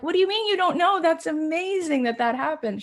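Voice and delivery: high, loud disbelief, pitched well up; amazed and excited. Retold or imitated. Reacting to a friend's big sale, loud amazed gushing. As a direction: gush with amazement at her news.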